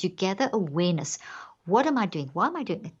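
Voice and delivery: ordinary delivery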